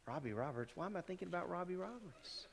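softly wondering to oneself, pitch rising; puzzled musing. Acted. Preacher acting out a stray thought while driving, puzzled musing. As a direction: think aloud under a puzzled murmur.

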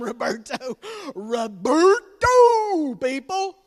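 very loud, stretched out shout; hammed-up shout. Dad hamming up his pun to viewers. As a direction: bellow the name slowly.

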